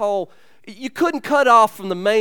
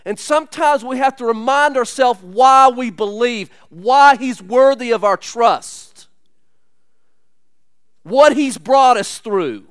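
rising preaching; 4 semitones higher, 7 dB louder than usual. Real, not acted. Preaching builds to full force.

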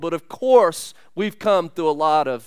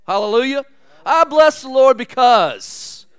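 joyful praise; 5 semitones higher, 6 dB louder than usual. Real, not acted.

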